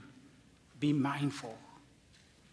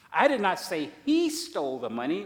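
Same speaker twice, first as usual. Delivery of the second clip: "he" stressed, louder and rising; 5 semitones higher, 7 dB louder than usual. Shown as a demonstration. Preacher showing someone else is meant, stress on HE. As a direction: point at "he" with emphasis. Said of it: "you're implying somebody stole it".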